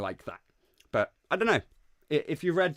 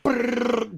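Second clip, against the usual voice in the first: rapid mouth trill; 12 semitones higher, 6 dB louder than usual. Retold or imitated. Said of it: "I was just like".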